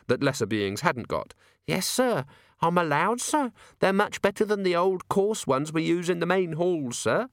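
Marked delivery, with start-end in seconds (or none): 1.7-7.3 put-on high young maid voice. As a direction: voice the nervous maid, higher.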